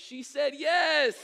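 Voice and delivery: loud, high, drawn-out celebratory shout; triumphant announcement. Retold or imitated. Fake fiancé announces to the mall crowd, shouting triumphantly. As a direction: shout it to the crowd, stretching "yes". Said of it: "I stood up and I was like".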